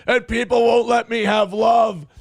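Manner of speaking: mocking the streamer crying on stream. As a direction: ham up a self-pitying crybaby. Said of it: "He's crying because".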